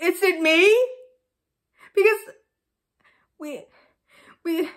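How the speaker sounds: tearful melodramatic pleading; tragic heroine sorrow. Acted. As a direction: sob it out like a soap heroine. Melodramatic breakup, crying.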